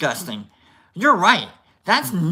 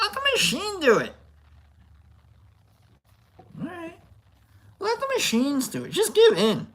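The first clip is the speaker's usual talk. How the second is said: mock airy robot guru; 11 semitones higher than usual. Vlogger urges viewers to let machines work, high and dreamy. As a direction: coax listeners to give in.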